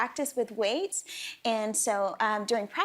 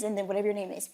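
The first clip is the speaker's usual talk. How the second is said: usual speaking voice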